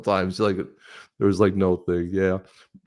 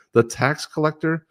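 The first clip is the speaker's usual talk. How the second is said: the tax collector, drawn out, pitched up high in mock disbelief; mock incredulous; 8 semitones higher, slower than usual. Host scoffing at the union endorsing Harris, drawn-out mock disbelief.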